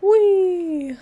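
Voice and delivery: a high gliding whee of delight; gleeful whee. Real, not acted. Streamer gliding off a ledge, gleeful high whee. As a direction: squeal it as you jump and glide. Flying off a cliff in game.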